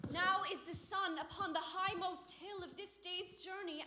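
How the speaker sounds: usual speaking voice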